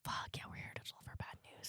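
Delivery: hushed unvoiced whisper. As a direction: whisper it like a guilty secret. Voicing the group's dread.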